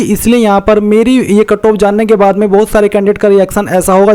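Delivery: ordinary talk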